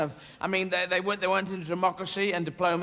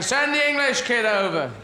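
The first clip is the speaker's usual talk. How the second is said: head teacher calling out; 6 semitones higher, 5 dB louder than usual. Retold or imitated. Head teacher ordering pupil across.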